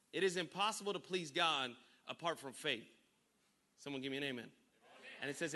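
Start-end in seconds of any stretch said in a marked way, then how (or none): 4.4-5.1 whisper. Real, not acted. Whispered after amen call.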